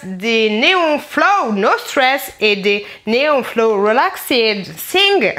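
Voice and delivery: slow, drawn-out English product names; mock English reading. Real, not acted. Vlogger reading English mask names in a slow mock English accent. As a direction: spell out the English names with exaggerated care.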